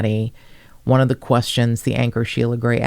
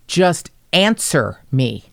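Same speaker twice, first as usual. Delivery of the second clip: normal talking voice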